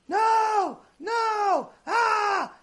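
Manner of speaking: high-pitched drawn-out cry of no; imitated scream. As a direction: wail it out in imitated terror. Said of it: "and then start screaming".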